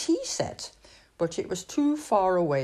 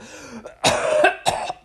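small cough; 3 semitones higher, 4 dB louder than usual. A narrator's reading. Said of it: "and now a cough".

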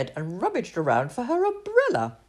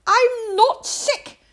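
put-on high, slow childish protest; arguing little princess; 7 dB louder, much slower than usual. Princess argues with the king, high tearful child voice. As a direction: insist like a sulky child, with a crying edge. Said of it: "argued the little princess".

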